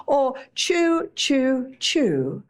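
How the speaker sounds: drawn out chew, chew, chew; each chew spaced out and unhurried; slow chewing. Shown as a demonstration. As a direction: take your time over every chew. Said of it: "until it's almost a cream and then swallow".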